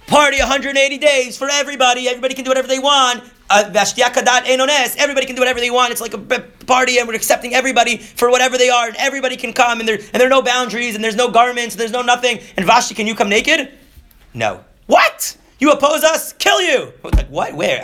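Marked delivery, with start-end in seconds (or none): 0.1-13.7 high, loud, gushing mock partier; mock party crowd. Mocking the Persian party crowd, high and loud. 14.9-16.9 shrill, loud mock fury; mock outrage. Mocking the king's men turning on Vashti.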